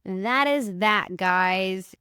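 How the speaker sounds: drawn out, slow and final; slow, deliberate closing word; slow final verdict. Real, not acted. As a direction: lay it down slowly like a closing line.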